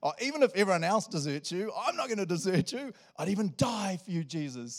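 loud, high, hammed-up bravado; arrogant boast. Peter vowing to Jesus he'd die for him, cocky. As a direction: proclaim undying loyalty, full of yourself. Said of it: "He's such an arrogant jerk".

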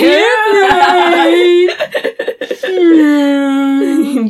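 long, loud high swooping whine through laughter; airplane noise. Pretend. Host pretending to feed fries, loud mock airplane noise. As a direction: mimic a plane coming in, drawn out and high.

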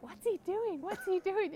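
high-pitched onlookers' question; acted bewilderment. Storyteller voicing friends watching from behind, high bewildered mimicry. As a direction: imitate baffled friends, pitched well up.

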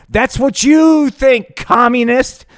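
high, loud, slow hero comeback; loud, high, drawn-out heroic retort; Slider. Acted. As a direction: deliver it like a cartoon hero. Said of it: "Slider said".

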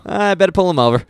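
high, loud eager cop voice; Wiggum-style eager cop. Retold or imitated. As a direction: pounce on a tiny offence like a keen policeman. Imitates Wiggum spotting minor offence.

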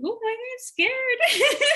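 high, slow, laughing through the fear; laughing mock fright. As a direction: play the fear for laughs, giggling. Retold fear on the mountain.

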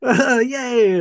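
high, hammed-up little cheer; mock cheering during a fall, high. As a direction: toss off a silly yay. Mock cheer while falling.

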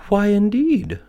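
very high, loud butterfly voice; fluttering butterfly. A narrator's reading. Butterfly echoes the lizard's question, very high and loud. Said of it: "said a butterfly who was fluttering".

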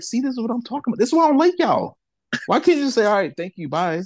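squeaky retold indignation; exasperated rant. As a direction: replay the complaint to the fan. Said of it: "I'm like".

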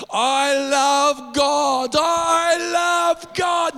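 slow, drawn-out, loud shouted praise; hammed-up slow yell of devotion; mock shout. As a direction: belt it out slowly like a zealot. Mimics man shouting in restaurant.

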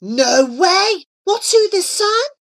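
high, loud excited disbelief; amazed. Acted. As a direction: react with eager astonishment. Friend amazed by news.

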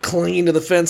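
usual speaking voice

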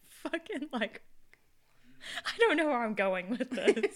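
high, helpless laugh through the words; words dissolving into drawn-out giggles. Real, not acted. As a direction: lose your thread and crack up. Cracks up losing her thread.

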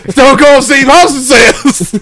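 high, drawn-out wrestler proclamation; Stone Cold Steve Austin. Acted. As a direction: announce it slowly like a hammed-up wrestler. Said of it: "Stone Cold Steve Austin God".

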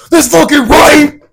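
high, slow triumphant yell; victory shout. Acted. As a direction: celebrate a big curling shot. Imagined celebration after a big shot.

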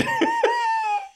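very high giggling squeal; shrill burst of laughter. Real, not acted. Laugh after Spike quote.

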